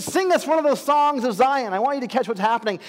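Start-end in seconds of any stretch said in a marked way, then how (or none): 0.0-1.7 mocking demand. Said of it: "They said".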